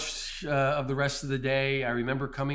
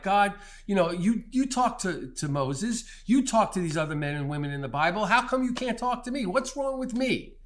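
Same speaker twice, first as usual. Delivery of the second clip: rising higher and faster, pleading; desperate plea; 6 semitones higher, 3 dB louder than usual. Retold or imitated. Running up the mountain, begging God to speak: rising desperate plea. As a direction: climb into an anguished cry to heaven.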